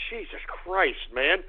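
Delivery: normal talking voice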